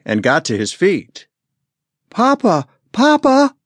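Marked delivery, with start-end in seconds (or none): 2.1-2.7 put-on high, loud little boy calling for his papa; a small boy calling out, pleading; boy runs to his father, calling high; pleading child's call. A narrator's reading. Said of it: "Bean entreated". 2.9-3.6 put-on even higher, louder child's plea; a little boy crying out for papa, urgent; boy reaching his father, louder and higher; desperate child's cry. A narrator's reading. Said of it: "Bean entreated".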